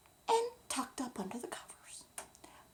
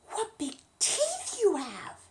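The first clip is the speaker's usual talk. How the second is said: wondering; 7 semitones higher, 8 dB louder, slower than usual. Acted. Little Red frightened by wolf.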